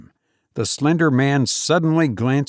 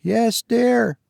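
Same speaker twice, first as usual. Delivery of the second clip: higher, from another room; a distant answering voice from upstairs; remote voice; 11 semitones higher than usual. Acted. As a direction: call back from far off in the house. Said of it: "came a remote voice".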